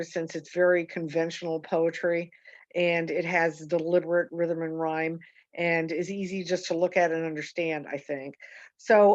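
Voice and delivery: usual speaking voice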